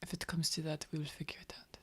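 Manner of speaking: unvoiced hushed whisper. Companion reassuring friend in the carriage, whispered. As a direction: whisper so the guards can't hear.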